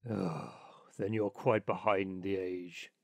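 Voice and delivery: low, disdainful rat voice; low-pitched, superior Water Rat; dismissive Water Rat. Acted. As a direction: scoff in a low storybook animal voice. Water Rat scorns the Linnet.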